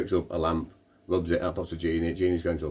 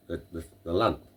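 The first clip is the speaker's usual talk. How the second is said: usual speaking voice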